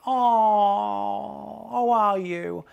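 mock someone faking that they care; fake empathy. Mocking a fake friend who pretends to care about your bad day. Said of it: "some people who fake empathy".

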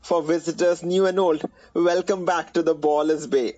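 upbeat rhymed show opener; jingle intro. Acted. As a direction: announce it like a radio jingle.

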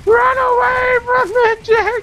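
very high, hammed-up scream. Gamer running from a chicken, high panicked wail. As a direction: stretch the last word into a long mock-terrified wail.

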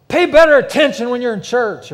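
loud, high-pitched divine command; booming voice of God. Acted. God rebukes the people on the ground, booming. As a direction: thunder it out as the Almighty. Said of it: "the voice of Almighty God comes out and it says".

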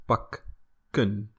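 "pakken" slowly, short clipped a; slow model of pakken; short Dutch a. Shown as a demonstration. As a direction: stretch out "pakken", stress the short a before the double k. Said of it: "because there's two consonants".

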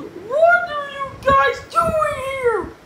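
high, loud, stretched-out demand; snarly. A narrator's reading. Yesterday George finding the boys in the office, snarly. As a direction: demand it loudly, high and slow.

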